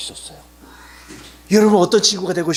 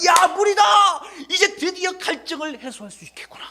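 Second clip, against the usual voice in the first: acted excited cry; 12 semitones higher than usual. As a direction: cry out in joyful relief. Thirsty people suddenly find water. (신나서 높게 외침)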